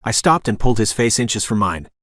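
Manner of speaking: usual speaking voice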